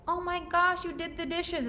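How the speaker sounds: high, patronizing gasp; condescending surprise. Shown as a demonstration. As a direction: talk down to him as if amazed. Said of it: "it's condescending".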